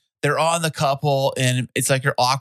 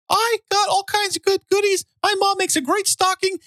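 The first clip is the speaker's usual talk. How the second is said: very high squeaky cartoon mouse voice; Mickey Mouse voice. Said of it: "that's your creepy Mickey Mouse voice".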